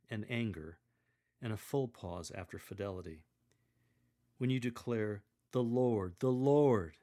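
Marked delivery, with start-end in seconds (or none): 5.5-7.0 each Lord louder and fuller; the second "Lord" fuller and louder than the first; building resonance. Shown as a demonstration. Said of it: "say each with more resonance than what preceded".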